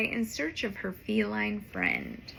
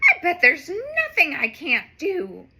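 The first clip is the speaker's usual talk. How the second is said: boastful; 4 semitones higher, 5 dB louder than usual. Acted. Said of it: "cries Streaky".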